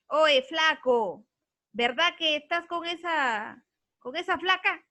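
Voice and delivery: loud, slow, drawn-out street call; mock street slang. Shown as a demonstration. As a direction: call out like a rough local guy. Maestra imita habla vulgar callejera. (imitando a un chico de barrio gritando)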